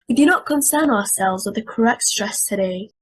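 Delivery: ordinary delivery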